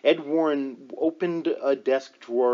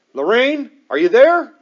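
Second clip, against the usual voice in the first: much higher and very loud, urgent shout; forceful call; 11 semitones higher, 14 dB louder than usual. A narrator's reading. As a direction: shout it to make her answer. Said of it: "he asked forcefully".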